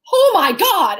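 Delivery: high, loud gasp of awe; retold burst of amazement; big, impressed exclamation; amazed outburst. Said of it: "we're like".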